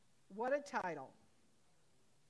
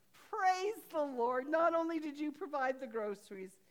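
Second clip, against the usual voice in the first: loud, high, slow joyful cry; triumphant praise; 6 semitones higher, 7 dB louder than usual. Retold or imitated. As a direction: draw out the praise in delight. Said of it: "she just went".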